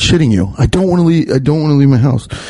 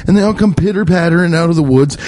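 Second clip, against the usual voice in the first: ordinary talk, nothing marked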